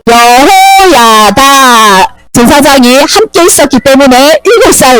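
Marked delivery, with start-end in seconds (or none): 0.1-2.2 여호야다 hugely stretched, every vowel held; low, very slow 여호야다; choral recitation. Shown as a demonstration. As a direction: chant 여호야다 out long for all to join. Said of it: "시작".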